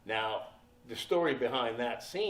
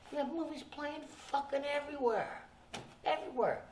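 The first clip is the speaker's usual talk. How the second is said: usual speaking voice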